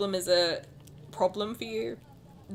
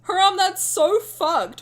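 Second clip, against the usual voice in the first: mock outrage; 8 semitones higher, 10 dB louder, slower than usual. Dramatic outrage at chat comment.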